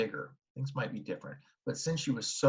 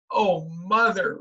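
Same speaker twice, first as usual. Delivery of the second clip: slow, dismayed wail; drawn out in dismay; girl repeats it slowly, dismayed at the cake; 8 semitones higher, 7 dB louder, much slower than usual. A narrator's reading. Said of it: "repeated Beezus".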